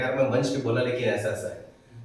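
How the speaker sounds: a speaker losing confidence, sighing it to himself; deflated self-talk. Retold or imitated. Speaker doubting himself after a stage mistake, softly deflated. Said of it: "आपका आत्मविश्वास कम होने लगेगा".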